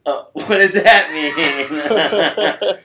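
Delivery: slow laughing mock offense. Real, not acted. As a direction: laugh through it, pretend insulted.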